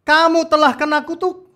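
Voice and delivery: high, loud scolding scripture quote; thundering curse. Acted. God rebuking Israel in verse nine, thundering. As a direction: proclaim it like an angry judgement. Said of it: "Ayat sembilannya bunyinya gini".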